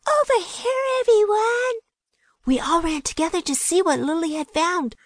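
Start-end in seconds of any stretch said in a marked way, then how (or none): 0.1-1.8 high shout across the house. A narrator's reading. Lily calls her friends from the kitchen, high shout. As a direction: call everyone over with a high shout. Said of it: "Lily shouted from the kitchen".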